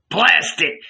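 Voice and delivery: high, punched exclamation; exasperated curse. A narrator's reading. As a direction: burst out with an impatient oath. Innkeeper curses at despairing Peter.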